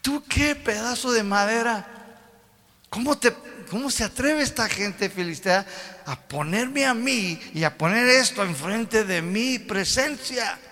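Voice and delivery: high, indignant God voice; God offended that an idol stands before Him; God rebuking the Philistines, high and indignant. Acted. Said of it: "Dios dice".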